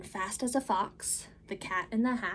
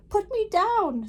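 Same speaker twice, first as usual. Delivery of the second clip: much higher, louder, anxious little fish; fretful fish; 11 semitones higher, 8 dB louder than usual. Acted. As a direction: squeal out the protest as a fish. Fish protests being held up.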